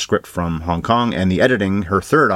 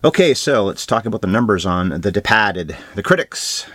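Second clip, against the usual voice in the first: ordinary talk, nothing marked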